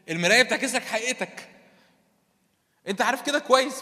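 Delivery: loud, high, driving sermon voice; fiery preaching. Acted. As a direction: push the point home to the congregation. Preaching at full force. (وعظ بحماس وصوت عالي)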